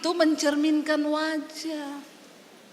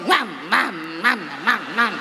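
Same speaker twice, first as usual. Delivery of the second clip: dog barking; 8 semitones lower than usual. Retold or imitated. Preacher mimicking a bitter woman as a barking dog, mock yap. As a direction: lower mock dog bark. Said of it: "Yaitu kayak dogi".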